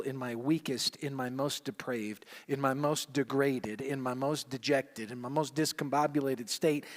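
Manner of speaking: normal talking voice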